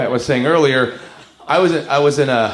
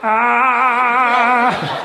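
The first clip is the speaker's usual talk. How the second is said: high sustained "ah" held on one note; mock zombie song; 8 semitones higher than usual. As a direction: hold a long, high, droning wail. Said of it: "Can you imagine a song going".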